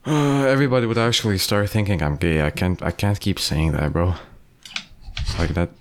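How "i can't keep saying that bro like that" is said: usual speaking voice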